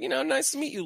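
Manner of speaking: high-pitched amateur impression; Deadpool voice. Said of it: "and he's like".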